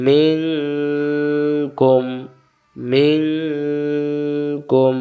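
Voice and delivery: মিং held in a long hum before কুম; hidden nun. Shown as a demonstration. Teacher showing the full word, long nasal মিং then কুম. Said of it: "তাহলে উচ্চারণ হলো কি?".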